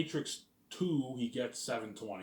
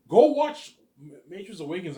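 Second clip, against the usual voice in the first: excited outburst; 7 semitones higher, 14 dB louder than usual. Real, not acted. Hyped about Matrix Awakens.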